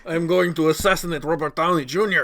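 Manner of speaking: laughing through a mock Russian villain line; a bad Eastern European accent, grinning through it; hammed-up villain accent. Riffing on Iron Man Two, laughing in a bad Russian accent. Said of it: "Fucking Oscar-worthy performance".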